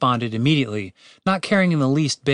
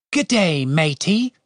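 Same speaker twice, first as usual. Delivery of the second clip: botched British, part Australian, part pirate; higher, hammy Australian-pirate accent; bad British accent; 5 semitones higher, slower than usual. A narrator's reading. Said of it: "more of a cross between Australian and pirate".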